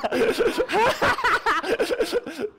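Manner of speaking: high loud laughing burst; loud high laughter. Real, not acted. Preacher laughing at his own skit, loud laughter. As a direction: crack up again.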